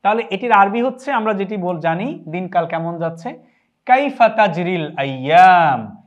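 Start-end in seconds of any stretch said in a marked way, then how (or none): 3.9-6.0 কাইফা তাজরিল আইয়াম said slowly and louder, each word spaced out; slow model pronunciation. Shown as a demonstration. Teacher to students, slow louder model of কাইফা তাজরিল আইয়াম. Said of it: "এখানটায় আমরা উচ্চারণ লিখেছি".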